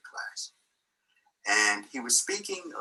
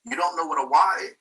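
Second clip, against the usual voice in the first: higher, louder mock indignation; imitated livid professor; 7 semitones higher, 7 dB louder than usual. Livid professor challenging a student, imitated indignant outburst. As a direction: act out his disbelief, loud and raised. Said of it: "And he became livid".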